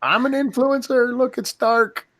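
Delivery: high-pitched mock influencer voice. Host mocks influencers filming darkened Times Square, high mock voice. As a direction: parody a vapid livestreamer.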